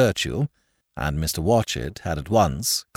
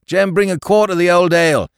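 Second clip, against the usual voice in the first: high, louder order across the bar; calling an order; 9 semitones higher, 7 dB louder than usual. A narrator's reading. Landlord orders ale from the potman, loud. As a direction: call out for the ale.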